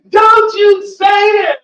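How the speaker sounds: high, drawn-out shout imitating an upset girl; tearful outburst. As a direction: scream it as a desperate demand to stop. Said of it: "tears coming, everything".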